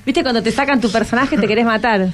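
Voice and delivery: normal talking voice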